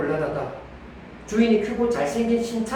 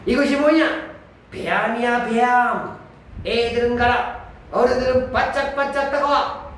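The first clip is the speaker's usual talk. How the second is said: medicine peddler pitch; 5 semitones higher than usual. Retold or imitated. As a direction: call it out like a market peddler. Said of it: "옛날에 약장사들이 많이 썼던 멘트".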